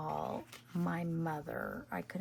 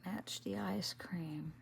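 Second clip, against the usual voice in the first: soft ASMR murmur; ASMR whisper; 6 dB quieter than usual. Acted. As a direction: whisper it to the viewers as you craft. Whispered crafting vlog chat.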